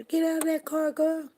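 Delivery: high-pitched mock holler at someone; retold holler. Telling Dominique to get out of the car, hollering. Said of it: "Did you hear me hollering at you".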